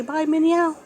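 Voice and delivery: high, loud, rising coax; pet talk. Real, not acted. Prompting cat to say bye.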